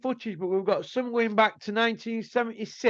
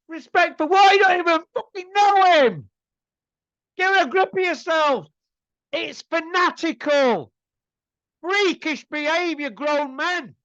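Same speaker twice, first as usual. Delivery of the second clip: much higher and louder, disgusted; furious rant; 8 semitones higher, 4 dB louder than usual. Real, not acted. Vlogger scolding fans waiting at the arena, furious and loud. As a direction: tear into them for grown men's silliness. Scorning fans mourning John Fury.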